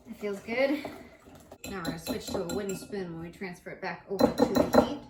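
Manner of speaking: usual speaking voice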